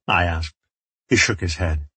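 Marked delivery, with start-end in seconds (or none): none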